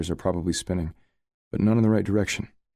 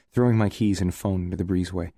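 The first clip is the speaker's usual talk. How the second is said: usual speaking voice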